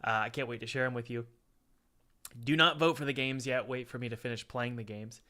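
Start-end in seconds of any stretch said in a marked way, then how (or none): none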